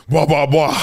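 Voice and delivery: normal talking voice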